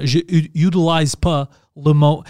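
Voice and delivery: "utilise" said like English "utilize", slowly; slow demo of "utilise" with an English you- onset; anglicized "utilise". Shown as a demonstration. Speaker showing friend how anglicisms sound in French, anglicized "utilise". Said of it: "certains mots que je dis différemment".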